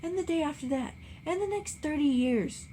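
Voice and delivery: wife wails at the endless summer. A narrator's reading. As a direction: stretch out the years in despair. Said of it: "cried Daisy".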